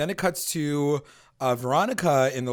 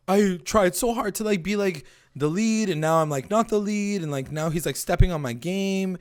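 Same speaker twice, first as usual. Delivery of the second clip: higher, whining complaint; mock self-pity, pitched up; whiny baby; 6 semitones higher than usual. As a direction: whine like a sulking teen boy. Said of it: "Stop being such a baby".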